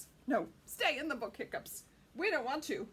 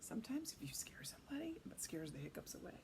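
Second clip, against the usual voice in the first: whisper; 9 dB quieter, faster than usual. Real, not acted. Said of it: "reading aside in whisper".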